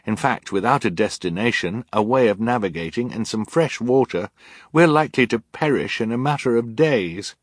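put-on high, insistent girl's voice; urgent reasoning. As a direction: press each point on a stubborn adult. Violet argues urgently with Olaf.

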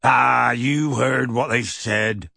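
slow, rustic brush-off; dismissive. A narrator's reading. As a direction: wave the worry away. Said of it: "said Hagrid dismissively".

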